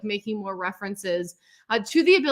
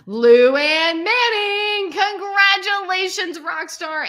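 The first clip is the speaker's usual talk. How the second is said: slow, stretched name called out higher and louder; excited announcement; 8 semitones higher, 7 dB louder, much slower than usual. Real, not acted. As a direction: announce her like a game-show winner.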